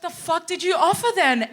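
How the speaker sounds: high, incredulous comeback; indignant mock retort. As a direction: fire it back in mock outrage. Acted retort to boss.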